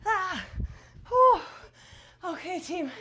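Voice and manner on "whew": loud breathless blow-out; tired whew. Real, not acted. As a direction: blow out hard after the effort. Loud exhale after hard exercise.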